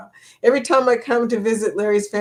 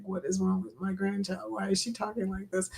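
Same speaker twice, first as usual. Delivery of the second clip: unhappy grandmother; 11 dB quieter than usual. Retold or imitated. Grandmother baffled by her grandchild's English accent, quiet displeased mutter. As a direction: quote a displeased grandmother under your breath. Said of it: "she was not happy".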